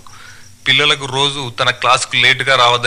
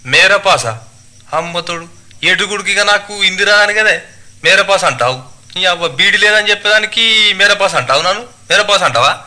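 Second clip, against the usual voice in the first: higher-pitched indignant villager; tailor picking a fight; 6 semitones higher, 4 dB louder than usual. Acted. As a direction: quarrel hotly in village dialect. (కోపంగా గొడవపడే దర్జీ, యాసలో)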